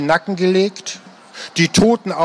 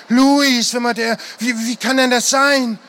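higher, halting cry of disbelief; stammering higher voice in shock; 6 semitones higher than usual. A narrator's reading. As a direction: plead that it can't be true. Mike in shock.

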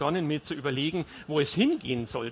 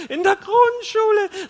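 very high, loud, drawn-out mocking echo; laughing disbelief; 9 dB louder, slower than usual. A narrator's reading. Wife mocks husband's primary-school promise, shrieking laughter. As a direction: shriek it back in hilarity. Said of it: "Dann lachte sie los".

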